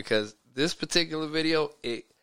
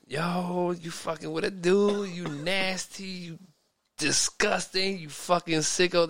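put-on quoted baby mama; 6 semitones higher than usual. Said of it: "she was calling, uh, her baby father".

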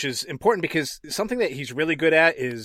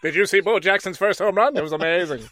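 put-on old-time radio announcer voice, louder and higher; 1930s radio announcer; 4 semitones higher, 5 dB louder, faster than usual. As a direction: call it like a vintage newsreel. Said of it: "like a nineteen thirties radio announcer".

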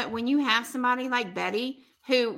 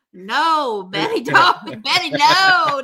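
mock pleading; 3 semitones higher, 7 dB louder, slower than usual. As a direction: call out to stop her, half laughing. [name] begging Betty to stop.

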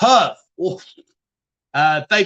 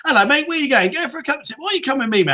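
mock pushy street tout, pitched higher; imitating a timeshare tout; 7 semitones higher, faster than usual. Recalling selling timeshare to the chat: hammed-up tout. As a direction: reel in tourists like a cheeky Spanish street tout. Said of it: "one of them bloody annoying touts".

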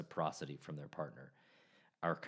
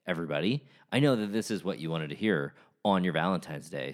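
ordinary delivery

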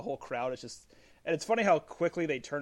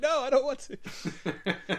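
whiny refusal; 13 semitones higher, 7 dB louder, much slower than usual. Retold or imitated. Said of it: "He's like".

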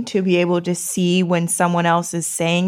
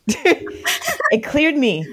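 laughing relief; 8 semitones higher, much slower than usual. Real, not acted. Overwhelmed relief.

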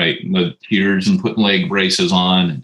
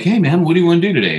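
eager greeting; 8 semitones higher, much faster than usual. Retold or imitated. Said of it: "I'd be like".

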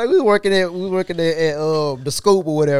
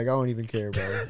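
low, quiet deadpan; underplay it with a straight face; straight-faced; 8 semitones lower, 9 dB quieter than usual. Retold or imitated. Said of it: "I just be doing straight face".

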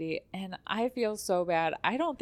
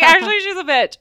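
tearful laugh; 12 semitones higher, 14 dB louder, faster than usual. Retold or imitated. Tearful laugh retelling insult.

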